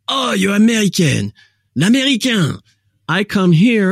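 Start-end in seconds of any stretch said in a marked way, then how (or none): 0.1-2.6 mock French voice. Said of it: "and everybody's like".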